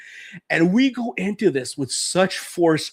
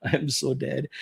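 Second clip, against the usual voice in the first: quiet, lightly voiced dread; hushed mock dread; 3 semitones lower, 5 dB quieter than usual. Boy dreading his mother, mock doomed under breath. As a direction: mutter the doom to yourself, half-joking. Kid dreading getting caught.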